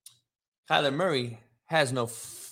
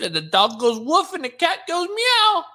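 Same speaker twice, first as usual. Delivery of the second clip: mocking; 12 semitones higher, 4 dB louder than usual. Mocking a commenter's misquote.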